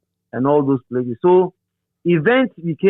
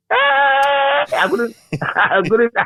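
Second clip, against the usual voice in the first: laughing; 9 semitones higher, much slower than usual. Real, not acted. Laughing at own blunder.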